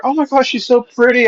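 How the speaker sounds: high-pitched mock cooing; imitated gushing. Parents admiring the speaker's daughter, imitated gushing. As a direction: fawn over a baby like an admiring parent.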